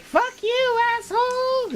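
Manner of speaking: put-on high squeaky kid yelling an insult; cheeky child shout. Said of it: "He's just like".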